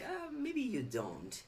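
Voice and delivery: high, soft, drawn-out musing; teasingly slow, high-pitched doubt; mock reply to opponent. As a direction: stretch it out slowly, high and soft. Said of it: "and then like".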